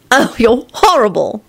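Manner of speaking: high, drawn-out playful scolding; teasing. A narrator's reading. Elizabeth to smiling Lupin, teasingly. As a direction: tease it out slowly. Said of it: "she responded teasingly".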